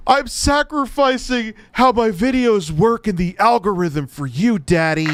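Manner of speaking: high, loud mock pleading; mocking devotion. Streamer mocking a YouTuber's devotion to Elon, hammed-up pleading.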